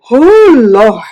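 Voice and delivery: loud startled cry of relief; a loud, surprised Oh Lord. Retold or imitated. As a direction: let it burst out, loud and amazed. Reacting to arrival.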